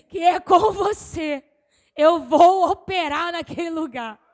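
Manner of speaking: loud, slow fervent preaching; preaching at full force. Acted. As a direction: cry out the plea to God. Preaching with music, prayer voiced. (pregação inflamada e lenta)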